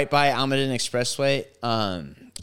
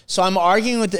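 ordinary talk, nothing marked

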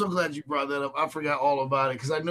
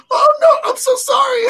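much higher, louder mock squeal of sorry through a laugh; embarrassed apology; 11 dB louder than usual. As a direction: play her horrified as she realises her gaffe. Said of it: "then she goes".

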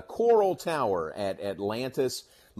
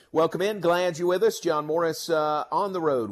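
loud, raised on-air welcome; shouting over wind; 7 semitones higher, 6 dB louder than usual. Real, not acted. Broadcasting outdoors on windy day.

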